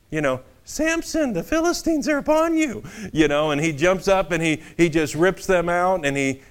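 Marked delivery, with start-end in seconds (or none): 0.6-2.8 high, squeaky cartoon voice for Delilah; shrill the alarm like a cartoon; cartoonish Delilah. Delilah crying out to Samson, cartoonish high voice. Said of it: "it's almost cartoonish".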